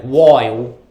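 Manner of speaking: "while" as "woile", with an OY vowel; Australian vowel. Shown as a demonstration. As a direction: stretch "while" with an Australian OY sound. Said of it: "like there's an O in the word".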